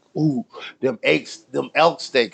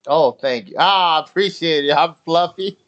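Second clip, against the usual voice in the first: normal talking voice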